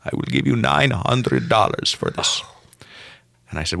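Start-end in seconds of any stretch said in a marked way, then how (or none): 0.0-2.4 put-on Russian accent. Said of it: "Your, your Russian American accent was on point".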